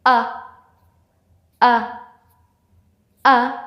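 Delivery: "uh" as a short, separate vowel; isolated short-vowel "uh" for a phonics lesson; short U sound. Shown as a demonstration. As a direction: say each "uh" short, pauses between. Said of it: "It's short sound".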